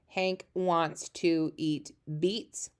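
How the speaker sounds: stretched out very slowly; slow read-along. Shown as a demonstration. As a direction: read each word slowly for the class. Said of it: "reading the sentence aloud".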